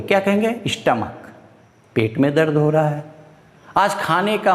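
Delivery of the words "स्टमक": स्टमक said with a short u sound, not स्टोमक; correct pronunciation. Shown as a demonstration. Teacher correcting स्टोमक to स्टमक, short vowel. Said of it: "स्टोमक नहीं बोलेंगे".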